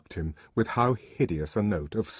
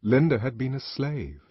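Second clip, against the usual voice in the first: plain reading voice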